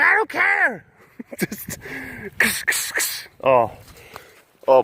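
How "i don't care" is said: very high, drawn-out dismissal; hammed-up merciless taskmaster cry; mock heartless yell. Driver refusing the collapsing laptop's plea, mock yell. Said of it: "I'm like".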